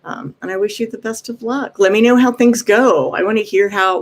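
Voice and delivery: usual speaking voice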